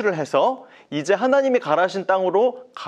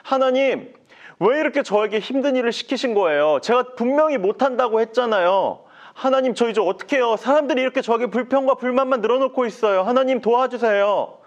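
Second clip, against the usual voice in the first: higher, pleading complaint to God; distressed Moses; 7 semitones higher than usual. Acted. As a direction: beg for help, at wit's end. Moses begs God for help. (높게 하소연하는 모세)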